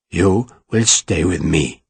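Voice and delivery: low, slow and flat, no emotion; flat repetition. A narrator's reading. Ferragus repeating his order to Sarah, flat and low. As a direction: repeat it deadpan, like a settled fact. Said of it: "Ferragus repeated flatly".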